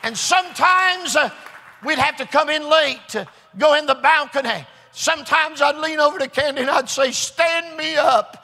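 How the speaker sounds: high, driving preacher delivery building to a shout; rousing preaching. Real, not acted. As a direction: build the testimony into a shouted, triumphant promise. Preaches at full force.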